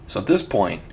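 ordinary talk

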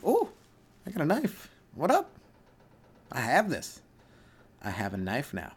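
a hint of a little kid bragging about her knife; toddler with a knife. Comic telling listeners, a baby proud of a knife, lightly voiced.

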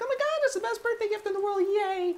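very high, fast kiddie squeal of joy; gushing kid. Retold or imitated. Bart unwrapping the doll, very high fast kiddie gush. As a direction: gush like a thrilled little boy. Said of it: "he's like".

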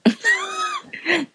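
very high squealing laugh burst; helpless high-pitched laughing fit; shrieking laugh. Real, not acted. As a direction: let out a piercing shriek of laughter. Laughing at friend's remark.

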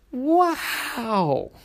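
high, bright amazed gasp; amazed wow. Acted. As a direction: gasp it in wonder. Tortoise amazed.

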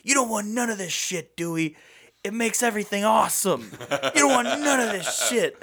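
high, fast hyped dealer voice; Tim Meadows dealer voice. Retold or imitated. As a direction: sell the drug like a smooth hustler. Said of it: "And he's like".

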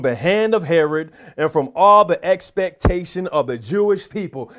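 scripture reading. Acted. Preacher reading Acts aloud to the church, declaiming loudly. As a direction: proclaim the verse to the congregation.

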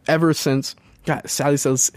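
normal talking voice

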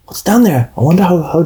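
put-on high radio-play child voice; kid character voice. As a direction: play a nosy kid peering down a well. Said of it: "I'm gonna be Lassie for one of the voices".

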